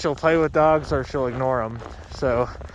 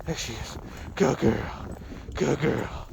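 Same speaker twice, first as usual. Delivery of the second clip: quiet praise for the dog; soft praise; 7 dB quieter, slower than usual. Real, not acted.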